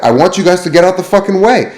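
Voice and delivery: high, loud, rude demand; angry cook. Retold or imitated. Cook ordering two servers to move, high rude demand. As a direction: shove them aside with a barked order. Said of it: "he's like".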